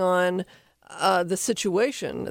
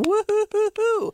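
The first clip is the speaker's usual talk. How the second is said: high, rolling woo-hoo-hoo; gleeful dog whoop; 13 semitones higher than usual. Retold or imitated. Host voices a dog thrilled at the treat-bringer, high whoop.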